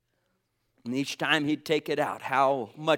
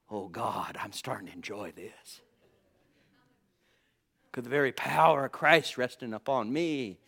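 Paul; 3 semitones lower than usual. Acted. Voicing the apostle enjoying his suffering to God, hushed and low. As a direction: murmur it low, as a prayer of enjoyment. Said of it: "you might get kooky like Paul".

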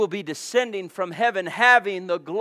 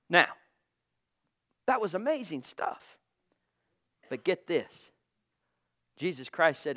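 normal talking voice

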